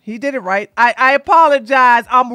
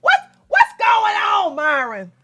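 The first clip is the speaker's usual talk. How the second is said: mock bafflement; 5 semitones higher than usual. Mock baffled repeated question.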